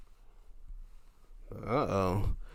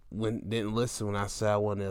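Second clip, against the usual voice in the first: ordinary talk, nothing marked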